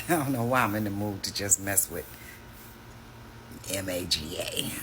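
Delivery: usual speaking voice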